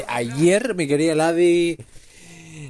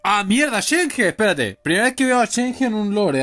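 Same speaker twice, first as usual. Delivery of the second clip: surprised; 6 semitones higher than usual. Real, not acted. Streamer spots Shenhe in a lore quest for the first time, surprised. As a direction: caught off guard, higher-pitched reaction. Unexpected character appears in game.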